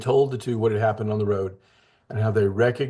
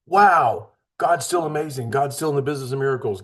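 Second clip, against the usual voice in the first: genuine excitement; 3 semitones higher than usual. Shown as a demonstration. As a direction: marvel aloud at God's work. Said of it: "No, like".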